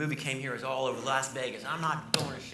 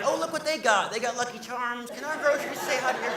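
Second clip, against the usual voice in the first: put-on much higher, sweet, childlike chatter; cutesy grocery voice; 10 semitones higher, 5 dB louder than usual. Groceries greeting other groceries in a tiny cute voice. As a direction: make the groceries sound adorable.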